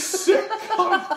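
put-on high, loud Scottish accent; laughing, high-pitched Scottish swearing; Sean Connery style. Friends riffing on Scottish swearing, high mock-Scottish accent. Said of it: "you're singing so Sean Connery style".

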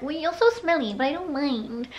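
high, sing-song pet talk; voice for doggies. Retold or imitated. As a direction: imitate fond baby talk to a dog. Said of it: "say that when the doggies".

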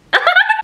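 a sudden high burst of giggling; high giggle. Real, not acted. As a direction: laugh at the charms breaking off. Laughing at broken charms.

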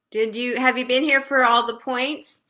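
loud call across the room. Real, not acted. Teacher calls over to Kimberly, loud. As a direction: raise your voice to reach her.

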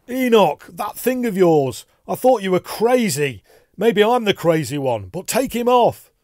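loud, high panicked outburst; desperate high-pitched shouting; frantic yelling. A narrator's reading. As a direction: rant at the top of your voice, terrified. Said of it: "he yelled".

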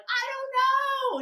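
very high, slow sulky whine; whiny high "I don't know". Retold or imitated. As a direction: drag it out like a sulky kid. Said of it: "And she's like".

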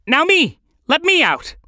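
high, loud eager cry; frantic excitement, pitch way up; urgent demand. Acted. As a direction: call out impatiently to be let out. Caged bird begs to be freed.

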